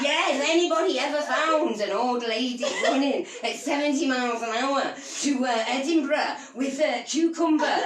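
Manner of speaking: hammed-up Scottish accent with laughter; comic Scottish appeal to the crowd; Billy Connolly. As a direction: deliver the punchline in mock Scottish. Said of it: "Scottish accent and vast love of Billy Connolly".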